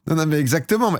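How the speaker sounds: ordinary delivery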